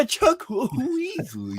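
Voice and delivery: very high, drawn-out comic character voice; exaggerated high-pitched character name; Chuck Weasley. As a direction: squeal the name out slowly in character. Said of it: "That was my favorite character you ever did".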